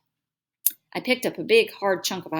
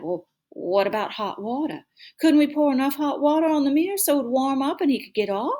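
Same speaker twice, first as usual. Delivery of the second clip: worried Southern; 7 semitones higher, 4 dB louder than usual. A narrator's reading. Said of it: "talking Southern style when she got worried".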